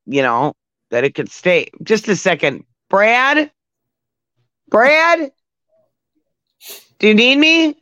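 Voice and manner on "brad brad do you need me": calling out. Real, not acted. Calling out to someone off mic.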